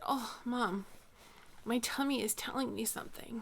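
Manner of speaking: soft whisper in a small voice; whispered child. Retold or imitated. Mom retelling her daughter's sly morning request, whispered. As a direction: breathe it like a kid sharing a secret. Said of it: "she said".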